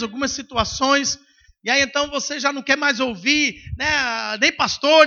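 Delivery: ordinary talk, nothing marked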